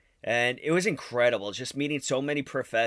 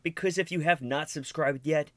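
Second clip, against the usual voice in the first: normal talking voice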